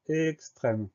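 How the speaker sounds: slow, drawn-out "extrême" for dictation; dictation word. Shown as a demonstration. Teacher announces the next word to spell, dictating slowly. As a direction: give "extrême" slowly, stretching its sounds. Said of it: "Le mot suivant".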